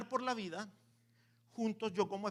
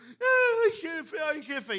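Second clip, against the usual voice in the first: high, whiny fake sob of a little demon; crying little demon; 8 semitones higher, 5 dB louder, much slower than usual. Little demon wailing to the devil, hammed-up crying. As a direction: wail to the boss like a crybaby imp. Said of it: "donde el diablo llorando".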